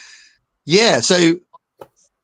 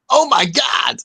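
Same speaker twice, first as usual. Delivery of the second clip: put-on American accent; 8 semitones higher, slower than usual. Host imitating the American reactor, mock American shout. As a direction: blurt it out like an amazed American. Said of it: "he was going".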